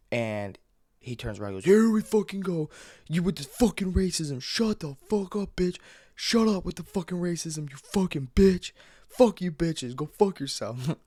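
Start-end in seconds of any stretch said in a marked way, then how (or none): 1.6-11.0 high, drawn-out mock tirade; imitated yelling. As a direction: imitate a man losing it, pitched way up. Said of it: "a lot yelling, screaming".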